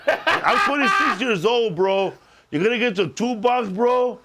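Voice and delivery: J-Rod voice. Retold or imitated. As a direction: hype it up like an Instagram electrician kid. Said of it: "it's a kid on Instagram named J-Rod".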